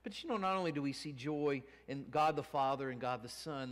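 usual speaking voice

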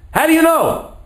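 very high-pitched challenging question; incredulous challenge. Retold or imitated. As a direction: challenge him, voice leaping up high. Challenges the survivor's certainty.

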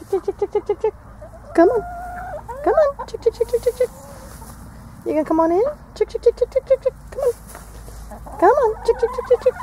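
high sing-song chick chick call; calling chickens. Real, not acted. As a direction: call the hens in, high and light.

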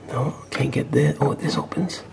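hushed, breathy whisper. Real, not acted. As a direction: keep it to a whisper. Whispering while exploring the safe.

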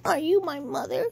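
hushed whisper of a timid bird; whispered question. A narrator's reading. Timid baby bird whispers to the cow. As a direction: ask it under your breath. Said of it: "he said to the cow".

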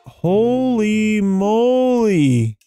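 stunned amazement. Real, not acted. Gamer reacting to a surprise in the game, stunned. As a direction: stretch it out in awe.

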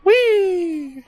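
high, joyful whee; gleeful whee. Real, not acted. Player flying through the game, gleeful squeal.

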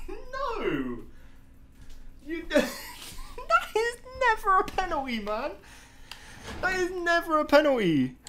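high, laughing disbelief; laughing outrage. Real, not acted.

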